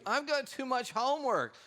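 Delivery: high, whiny mock student voice; complaining student. Student complaining to a parent or teacher, high mock whine. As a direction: whine it out high like an overworked kid. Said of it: "The student says to you".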